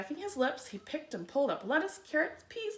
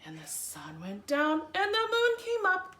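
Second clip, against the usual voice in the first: ordinary delivery, nothing marked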